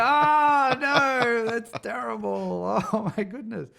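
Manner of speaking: high, wailing dismay; mock horror. Reacting to Australian accents on The Simpsons, mock-appalled. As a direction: cry out hammed-up horror, loud then softening into amusement.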